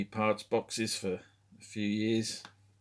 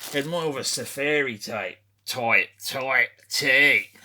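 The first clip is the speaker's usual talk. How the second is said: lightly done Australian outback accent, slow and louder; a hint of Paul Hogan's Australian drawl, stretched out; Mick Dundee impression; 3 semitones higher, 7 dB louder than usual. Shown as a demonstration. Australian accent for Mick Dundee describing his safari shirt. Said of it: "that's my Mick Dundee impression".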